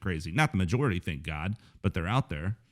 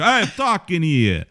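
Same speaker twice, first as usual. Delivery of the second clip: loud, high, drawn-out New Yorker bit; hammed-up New York accent; mock New York accent; 8 semitones higher, 6 dB louder, slower than usual. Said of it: "I wish I could do a New York accent".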